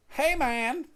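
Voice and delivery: high-pitched mock call-out; retold yell. Neighbor calling to him from the stoop, mock yelling. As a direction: holler over like a neighbor, not full force. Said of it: "he starts yelling at me".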